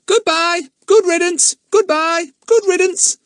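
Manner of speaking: high, loud parrot screech. Acted. Parrot jeering at departing children, loud high screech. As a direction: screech it after them gleefully. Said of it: "Kiki screeched after them".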